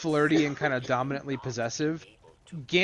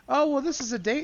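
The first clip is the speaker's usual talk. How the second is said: put-on much higher, teasing girlish voice; 10 semitones higher than usual. Granddaughter tells Remy it's a date, in a high teasing voice. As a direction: play the girl insisting it's a date.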